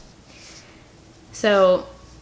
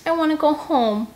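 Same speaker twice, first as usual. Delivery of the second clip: put-on whiny girl; 8 semitones higher than usual. Scarlet whining to go home.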